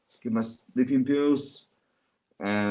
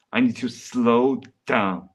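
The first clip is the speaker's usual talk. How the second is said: deliberately slowed down. Real, not acted. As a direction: stretch it out to rein in your pace. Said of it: "I'm talking too fast".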